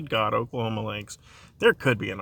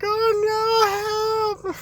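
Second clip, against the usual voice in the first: high, far-off fake distress wail; mock cry for help; 3 dB louder, much slower than usual. Pretending the octopus has him, high hammed-up wail for help. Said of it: "I'm like".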